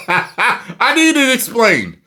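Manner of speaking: usual speaking voice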